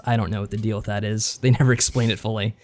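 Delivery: ordinary talk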